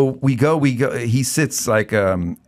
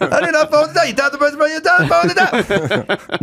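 much higher put-on voice, indignant; Larry impression. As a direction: go way up and protest. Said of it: "you just take on the Garland role".

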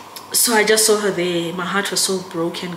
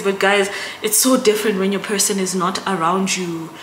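normal talking voice

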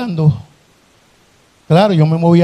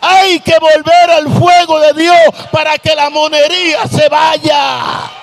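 preaching at full force; 9 semitones higher than usual. Acted. Preacher calling the church back to God's fire, shouting. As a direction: shout the call to return to the fire.

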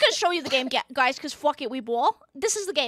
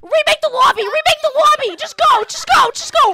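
panicked yelling; 8 semitones higher, 3 dB louder than usual. Real, not acted. Panicking at zombies in game.

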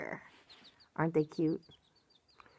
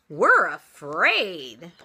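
loud, drawn-out storybook wail; frightened cry; 12 dB louder, much slower than usual. A narrator's reading. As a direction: stretch it out as scared little chickens. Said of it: "cried the little chickens".

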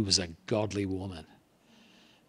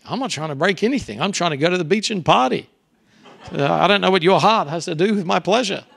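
put-on cocky teen; 6 semitones higher, 4 dB louder than usual. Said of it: "I was like".

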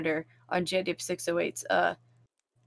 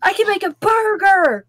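excited; 11 semitones higher, 10 dB louder than usual. Real, not acted. Excited discovery.

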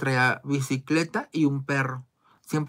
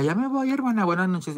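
sweet farewell; 7 semitones higher than usual. Retold or imitated. Regia says goodbye, mock sweet.

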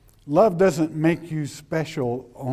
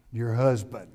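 normal talking voice

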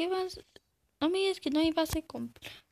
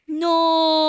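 sudden loud protesting no; 10 dB louder than usual. Real, not acted. Gamer yelling at the screen, loud no.

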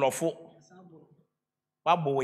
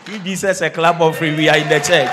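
loud shouted call to the congregation; rally the crowd at full volume; 9 dB louder than usual. Real, not acted. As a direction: shout it out to a whole church. Urging congregation to clap.

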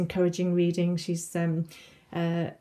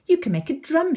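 high, excited mild shout; excited call; 9 semitones higher, 3 dB louder than usual. A narrator's reading. Sylvie pointing Eleanor to a tin, excited call. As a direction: call out the idea, pitched up. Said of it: "she shouts".

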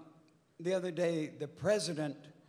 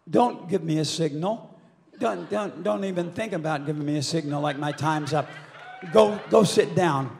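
loud, forceful telling-off; real scolding; 9 dB louder than usual. Real, not acted. Performer rebuking whoever signals time is up, loud and indignant. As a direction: shut them down at raised volume.

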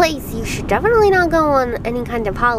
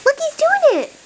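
excited. Real, not acted. Excited owner watching cat.

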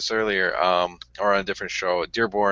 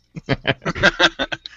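laugh; 8 semitones higher than usual. Real, not acted. Laughing at joke.

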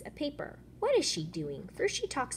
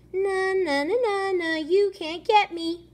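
high, loud na-na chant; singsong taunt; 11 semitones higher, 8 dB louder than usual. Acted. Fish taunts the fisherman in a high loud singsong. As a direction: tease like a cocky child.

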